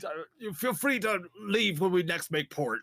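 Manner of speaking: hammed-up pirate drawl; slow mock pirate talk. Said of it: "your best pirate voice".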